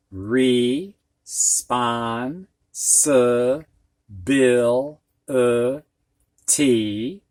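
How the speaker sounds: very slow, each syllable drawn out. Shown as a demonstration. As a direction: draw responsibility out syllable by syllable. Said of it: "put your hand underneath your chin".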